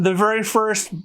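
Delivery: ordinary talk, nothing marked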